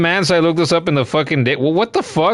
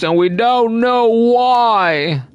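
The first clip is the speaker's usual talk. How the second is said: slow, high drawn-out line; mock dramatic; 6 semitones higher, much slower than usual. Player narrating his puzzled pet, slow and mock dramatic. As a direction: stretch it out teasingly.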